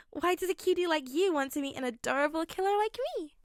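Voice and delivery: high, sing-song unhinged schoolgirl. Acted. Toga gushes over Izuku.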